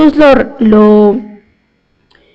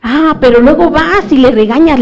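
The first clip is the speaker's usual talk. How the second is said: usual speaking voice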